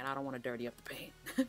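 ordinary talk

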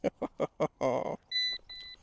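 real high-pitched gleeful laugh; delighted laugh. Real, not acted. Detectorist laughing at his coin find, delighted.

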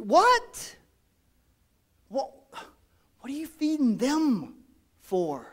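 high squeak of disbelief; mock shocked question; incredulous what. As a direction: pitch jumps way up in shock. Mock disbelief at feeding bikers.

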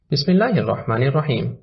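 plain reading voice

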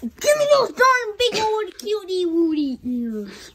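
put-on baby talk.

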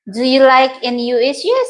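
usual speaking voice

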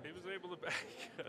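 words broken up by a high laugh; laughing while talking. Real, not acted. Commentator explaining the player's shot, laughing through it. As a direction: try to describe it through laughter.

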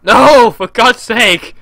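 slow, loud, exasperated yell; shouty voice. Real, not acted. Gamer failing the flight, exasperated shout. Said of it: "my shouty voice".

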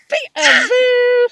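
high loud peekaboo breaking into laughter; laughing, shouted peekaboo. Real, not acted. Grandma revealing herself to baby, laughing shouted peekaboo.

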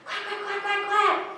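rapid high-pitched quacks; excited duck. Acted. Duck spots the movie theater, excited quacking. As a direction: quack fast and high, eager to go. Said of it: "but the duck looks excited".

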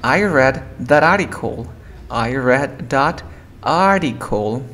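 slow model sentence, article said "ar-icle"; "article" drawn out without its T. Shown as a demonstration. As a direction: demonstrate slowly, dropping the T in "article". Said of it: "Por ejemplo, dirías".

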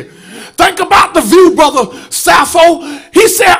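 loud, rising gospel preaching; full-force preaching. Acted. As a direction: preach it hard to the congregation.

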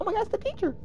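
very high, small squeaky imitation; soft falsetto mock amazement; mock excited player. Gamer imitates other players spotting the teacher, mock excitement.

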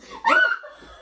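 very high squeal of laughter; sudden shrieking giggle; laughing. Real, not acted. Partner failing to compose themselves, high squealed laugh. Giggling after being told stop.

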